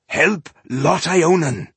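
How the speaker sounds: loud slow dwarf order, stress on Ionin; urgent command. Acted. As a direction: bark the name Lot Ionin slowly and loud. Dwarf pushes frightened Maga.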